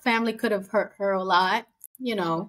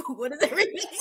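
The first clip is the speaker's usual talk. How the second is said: higher, laughing through it; laughing disbelief; 6 semitones higher, much faster than usual. Retold or imitated. Podcaster at sound bath, laughing. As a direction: giggle out the question. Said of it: "and I'm like".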